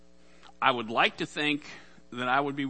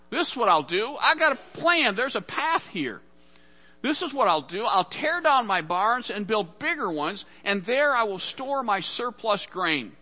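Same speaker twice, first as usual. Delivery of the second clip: scheming rich man; 8 semitones higher, 5 dB louder than usual. Acted. Rich man plans bigger barns.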